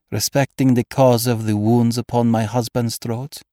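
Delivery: put-on troubled noblewoman, a touch of foreign accent; shaking foreign-accented voice. A narrator's reading. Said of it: "Her voice shook".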